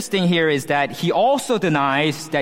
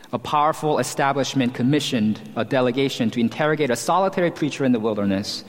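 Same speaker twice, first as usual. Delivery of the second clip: usual speaking voice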